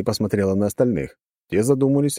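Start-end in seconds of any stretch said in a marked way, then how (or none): none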